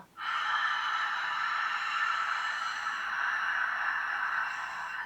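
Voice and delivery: long, soft ocean-sounding throat exhale; slow breath out through a narrowed throat; ujjayi breath. Shown as a demonstration. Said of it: "see if you can make a long".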